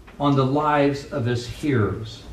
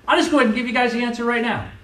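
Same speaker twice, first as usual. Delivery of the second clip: high, fast, breezy voice; playful high rush; imitating Mark; 9 semitones higher, much faster than usual. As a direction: toss off the answer, high and quick. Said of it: "He's like".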